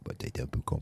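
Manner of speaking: low-voiced imitation of a southern French accent. Mentor judging a botched interview, imitated southern French accent. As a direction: murmur it with a southern French twang. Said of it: "je l'imite avec l'accent".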